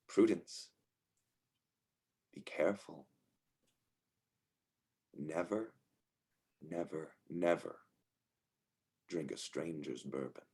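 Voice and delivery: hushed, near-whispered grandmotherly advice; grandmother's warning. Acted. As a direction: warn softly, with long pauses between phrases. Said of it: "your grandmother".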